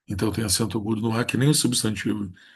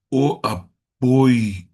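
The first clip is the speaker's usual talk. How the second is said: apoio slow and loud, final O split off; syllables separated; 2 semitones higher, 6 dB louder, much slower than usual. Shown as a demonstration. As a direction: break "apoio" into a-POI-o. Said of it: "separa apenas o O".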